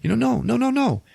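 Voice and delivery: high-pitched mock panic; acted-out frantic denial, pitch shooting up; flustered denial. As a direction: protest in a squeaky, climbing pitch.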